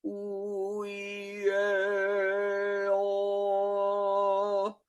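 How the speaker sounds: rounded "ooh, E, A, O", sung high and steady; lips pursed. Shown as a demonstration. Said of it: "If all the vowels are rounded".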